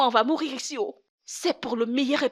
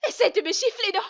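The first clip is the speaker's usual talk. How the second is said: defiant dare; 7 semitones higher than usual. Acted. Wife dares husband to slap her.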